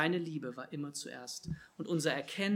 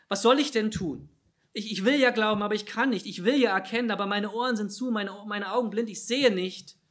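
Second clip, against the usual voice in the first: higher, louder desperate protest; desperate plea; 5 semitones higher, 9 dB louder than usual. Acted. Preacher voices a doubter wrestling with faith, desperate pleading. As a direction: plead urgently, as one struggling to believe.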